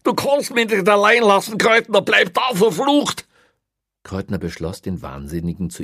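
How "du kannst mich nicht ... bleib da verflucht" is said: high, loud, desperate shouting; panicked yelling. A narrator's reading. Prisoner left alone in the cell, panicked yelling. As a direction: yell after him in desperation.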